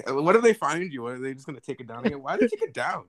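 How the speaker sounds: usual speaking voice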